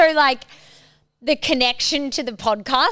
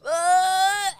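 mock gag; 8 semitones higher than usual. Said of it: "I was going".

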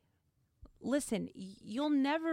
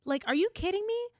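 incredulous; 9 semitones higher, 4 dB louder, faster than usual. Real, not acted. Outraged reaction.

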